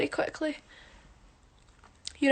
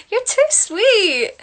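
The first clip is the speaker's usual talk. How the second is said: high, slow, gushing thanks; touched; 9 semitones higher, 6 dB louder, much slower than usual. Real, not acted. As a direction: melt at the compliment. Touched by compliment.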